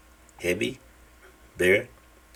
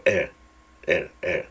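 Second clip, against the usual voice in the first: usual speaking voice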